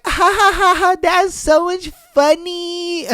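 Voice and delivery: high-pitched fake laugh into drawn-out gushing; mock swooning girl, high and slow; fawning girl. Girl laughing at a Chad's crude line, gushing in a high mock voice.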